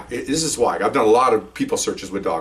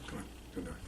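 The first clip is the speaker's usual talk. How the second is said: hushed call; 25 dB quieter, much faster than usual. Retold or imitated. Handler quietly calls dog.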